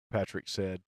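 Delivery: ordinary delivery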